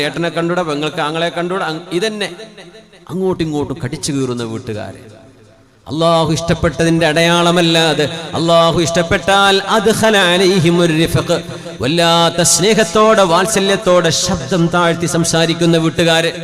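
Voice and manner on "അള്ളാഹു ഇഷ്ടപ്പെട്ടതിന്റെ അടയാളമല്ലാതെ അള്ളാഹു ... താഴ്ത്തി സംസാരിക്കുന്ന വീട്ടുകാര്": loud, higher, half-sung sermon chant; preaching at full force. Acted. Preacher to the congregation on loving homes, loud sung preaching. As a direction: pour it out like a fervent preacher, drawing out the Arabic.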